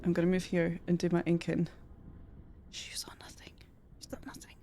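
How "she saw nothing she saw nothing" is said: whisper. Acted. Whispered aside.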